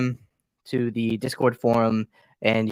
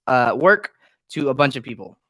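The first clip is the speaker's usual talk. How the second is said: ordinary talk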